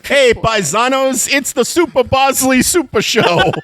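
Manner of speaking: cartoon Italian host welcoming paisanos; Italian accent show intro; mock Italian showman.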